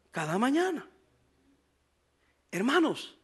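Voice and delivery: soft repeat. Shown as a demonstration. Pastor repeating the verse words to the congregation, softly. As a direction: let "cada mañana" fall quietly. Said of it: "Y dice cómo la traían".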